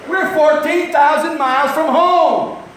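high, loud, drawn-out exclamation; hammed-up disbelief, stretching the words; astonished shout. Acted astonishment at distance.

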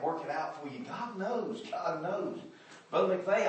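normal talking voice

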